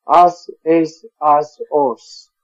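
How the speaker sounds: "As, es, as, os" as separate clipped syllables; isolating the shared endings; spelled-out endings. Shown as a demonstration. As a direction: sound out "As, es, as, os" one by one. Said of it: "They all have kind of a similar sound".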